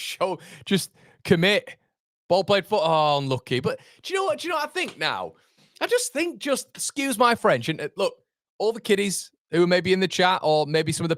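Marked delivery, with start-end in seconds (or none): 3.6-7.7 rising rant. Real, not acted. Building up to a rant.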